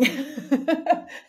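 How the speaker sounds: high bright burst of laughter; delighted laugh. Real, not acted. Remembering her course working, a delighted laugh. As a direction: laugh out in happy disbelief. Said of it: "I was flabbergasted".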